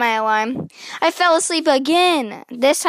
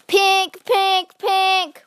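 alarm chime; 7 semitones higher, 3 dB louder, much slower than usual. A narrator's reading. Alarm sound imitated.

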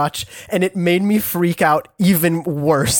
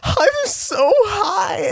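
high, slow mock sister voice; imitating sister; much slower than usual. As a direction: drag out "I'm so high" like a stoned sister. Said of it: "kept looking at me and going like".

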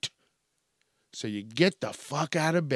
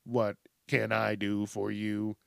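low, soft robotic monotone; measured, droning assistant greeting; standard assistant voice; 4 semitones lower, 5 dB quieter, slower than usual. Acted. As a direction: recite it like a stock voice assistant. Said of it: "the standard".

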